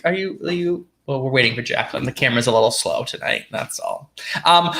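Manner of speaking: normal talking voice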